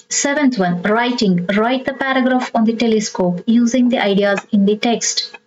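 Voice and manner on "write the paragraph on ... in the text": ordinary delivery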